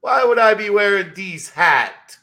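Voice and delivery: put-on a Half Baked style Jamaican accent, over the top; Jamaican impression. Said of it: "only accent I truly have down is Jamaican".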